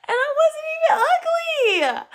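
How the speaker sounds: very high, drawn-out whine; loud high-pitched wail, stretched out; wailing protest. Real, not acted. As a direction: squeal it out in loud, wounded protest. Indignant comic protest about being bullied.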